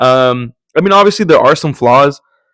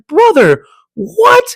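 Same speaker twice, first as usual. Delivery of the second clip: shocked disbelief; 4 dB louder, slower than usual. Retold or imitated. Shocked reaction while watching the film.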